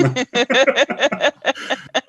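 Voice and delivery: high laugh. Real, not acted. Laughing after the audience's reply, high laugh.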